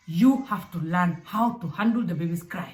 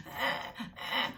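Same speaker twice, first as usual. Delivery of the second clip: put-on hoarse baby whimper; 4 semitones higher, 8 dB quieter than usual. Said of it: "They're like".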